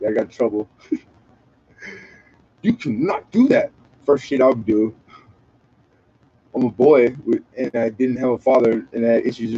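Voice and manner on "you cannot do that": much higher, scolding teacher imitation; mock stern teacher voice. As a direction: parrot a strict first-grade teacher. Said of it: "the teacher told you you couldn't".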